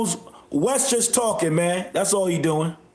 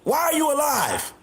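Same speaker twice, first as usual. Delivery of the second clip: mocking shout; 6 semitones higher than usual. Real, not acted. Ridiculing a commenter's question.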